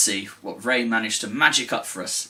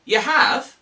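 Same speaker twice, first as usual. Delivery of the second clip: high and delighted, stretched slow; thrilled by the rabbit's news; excited; 10 semitones higher, much slower than usual. Pretend. Teacher overjoyed that Ray has something.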